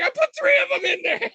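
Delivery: put-on very high, squeaky little-kid voice; gleeful toddler. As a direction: squeal it like a proud three-year-old.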